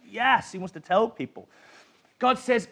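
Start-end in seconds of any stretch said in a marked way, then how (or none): 0.1-0.5 eager yes. Retold or imitated. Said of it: "He's like".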